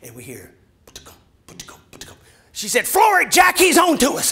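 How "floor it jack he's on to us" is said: high loud panicked wife; high frantic shout to Jack; panicked yell. Retold or imitated. Wife yells as cat chases.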